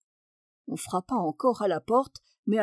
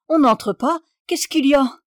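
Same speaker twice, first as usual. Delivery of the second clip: higher, louder call to someone outside; 4 semitones higher, 6 dB louder than usual. A narrator's reading. Baroness stops whoever knocks at the dressing-room door, calling out. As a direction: cry out in alarm at the door.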